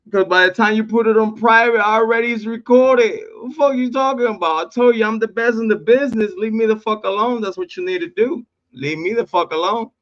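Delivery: high-pitched, boastful telling-off; gloating taunt. Real, not acted. Host tells off a rival who hid videos, high-pitched taunting.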